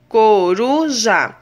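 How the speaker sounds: "coruja" split slowly into co, ru, ja; teacher sounding out "coruja" in three beats; syllable by syllable. Shown as a demonstration. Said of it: "trissílabas são aquelas que existem três".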